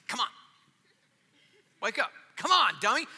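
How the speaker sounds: mock wake-up yell. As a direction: jolt yourself awake like a tough coach. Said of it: "I probably need a good".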